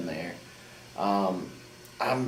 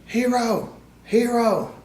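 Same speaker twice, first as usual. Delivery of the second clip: high shouted call across the ring; 13 semitones higher, 6 dB louder, slower than usual. Retold or imitated. Wrestler on the floor calling to his partner, high shout. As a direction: yell the name up high and loud. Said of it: "Bobby Fish got on the floor and was like".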